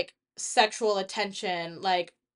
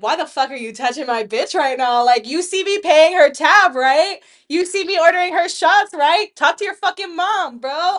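higher, much louder aggressive rant; angry shout; 5 semitones higher, 10 dB louder than usual. Retold or imitated. Protective friend yelling at a man touching her friend. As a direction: get in his face, protective.

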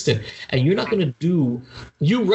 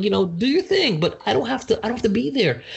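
exasperated high pitch; 8 semitones higher than usual. Retold or imitated. To a friend who keeps going back, exasperated and high. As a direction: raise the voice high, fed up and exasperated.

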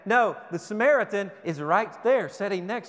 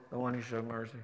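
much lower and softer, mumbled grudgingly; reluctant mumble; 9 semitones lower, 12 dB quieter, faster than usual. Retold or imitated. Lawyer grudgingly answering Jesus, barely audible mumble. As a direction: force the words out under your breath. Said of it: "they probably mumbled it".

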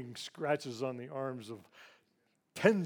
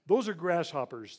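alarmed cry; 4 semitones higher, 7 dB louder than usual. Retold or imitated. Horror at the approaching swarm.

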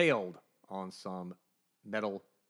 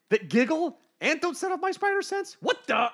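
incredulous Spider-Man; 8 dB louder than usual. Retold or imitated. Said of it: "as Spider-Man points out".

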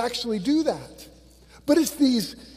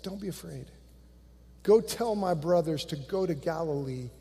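gentle comfort; 4 semitones lower, 7 dB quieter than usual. Acted. Jesus comforting the women.

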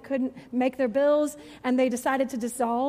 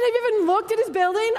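incredulous question; 7 semitones higher, 6 dB louder, faster than usual. Retold or imitated. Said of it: "I said".

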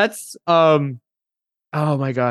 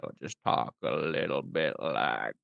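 low, slow, quiet creaky drawl; lazy, low vocal fry voice; 12 semitones lower, 10 dB quieter, slower than usual. Shown as a demonstration. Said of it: "a voice with vocal fry".

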